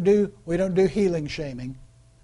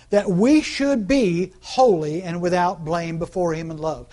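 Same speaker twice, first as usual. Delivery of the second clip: slow scripture reading; 6 dB louder, slower than usual. Acted. Preacher reading scripture verse.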